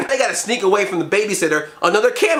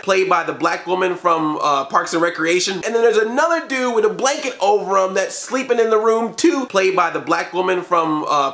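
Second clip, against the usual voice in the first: ordinary delivery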